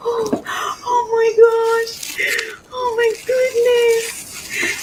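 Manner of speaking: high, slow, tearful wail; crying with joy. Real, not acted. Vlogger overwhelmed by owning the pot, crying happily. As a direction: wail it slowly, really overcome. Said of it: "I feel like I'm gonna cry".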